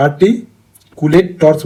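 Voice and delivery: আর্টিকুলেটর্স very slow, broken by a pause after "arti"; slow syllable-by-syllable. Shown as a demonstration. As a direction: spell out the term slowly in chunks. Teacher breaks up a new term. (আর্টিকুলেটর্স ধীরে ভেঙে ভেঙে উচ্চারণ)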